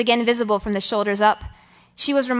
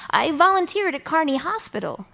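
higher-pitched, mildly eager opening; eager to confide; 6 semitones higher than usual. A narrator's reading. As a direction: begin as if hungry to tell someone. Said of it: "hungry to tell someone".

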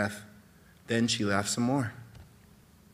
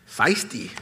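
amused grin; 3 semitones higher, 5 dB louder than usual. Acted. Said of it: "Wright said with a grin".

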